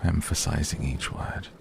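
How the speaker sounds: usual speaking voice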